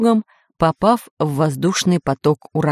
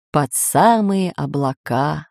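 ordinary delivery

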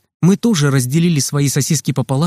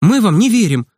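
put-on high, stubborn boy; mildly defiant insistence; 6 semitones higher than usual. A narrator's reading. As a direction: dig your heels in, pitched up. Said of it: "упрямо сказал Санёк".

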